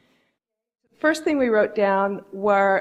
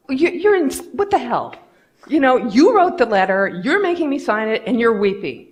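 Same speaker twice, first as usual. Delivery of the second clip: higher, louder mock incredulous boss; retold disbelief; 4 semitones higher, 6 dB louder than usual. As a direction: act baffled as the boss signing. Boss reacts to weeping exec.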